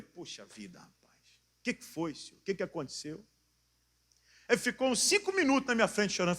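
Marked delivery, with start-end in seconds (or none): 0.0-3.2 soft, sympathetic retold question; hushed concern. Pastor to an older man crying before him, hushed concern. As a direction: gently ask what happened.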